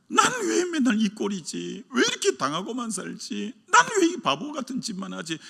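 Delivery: self-pity. Retold or imitated. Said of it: "그 상처난 모습으로 자기를 바라봐봐요".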